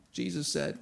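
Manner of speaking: normal talking voice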